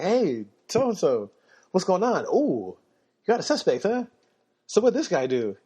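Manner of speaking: high, bouncy voice of a jokey fellow cop; chirpy second cop. Retold or imitated. Partner cop jogging up to his colleague, upbeat and nosy.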